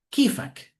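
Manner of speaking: "Kifak" said KEE-fak, falling; Syrian Arabic greeting. Shown as a demonstration. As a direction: Levantine "Kifak", short and crisp. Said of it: "En Siria dicen".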